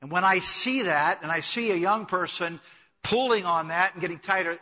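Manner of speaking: ordinary delivery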